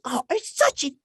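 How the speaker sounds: put-on high British-accented self-scolding, dropping on the name; exaggerated British lament, starting high; British boy impression. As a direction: berate yourself in a put-on British voice. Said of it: "how he was".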